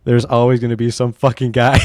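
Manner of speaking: ordinary talk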